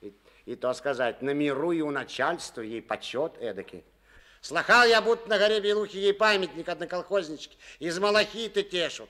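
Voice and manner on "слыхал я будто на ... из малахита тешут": loud, high, mocking tale; mocking boast. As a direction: tease her fame loudly. Nikon mocks her fame. (громкая насмешливая байка)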